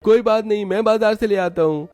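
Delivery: put-on high boyish voice, eager to help; young brother-in-law. Yash offers to fetch the vegetables, high boyish voice. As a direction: offer like a keen young lad.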